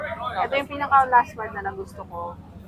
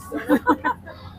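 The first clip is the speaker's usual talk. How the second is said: high breathy giggle of delight; high laugh. Real, not acted. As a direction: giggle high like you can't contain it. Reacting to the face. (mataas na hagikgik sa tuwa)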